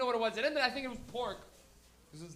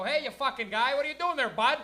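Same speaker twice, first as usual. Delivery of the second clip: put-on louder, higher Maritime accent, friendly bud; Maritime guy; 4 semitones higher, 6 dB louder than usual. Comic quoting a Halifax guy calling out, in a hammed-up Maritime accent. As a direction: hail a stranger like a Halifax local. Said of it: "you're never gonna hear some guy just go".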